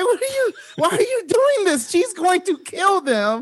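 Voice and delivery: high, pleading shock; mock dismay. Friend imitating a shocked bystander pleading, high squeak.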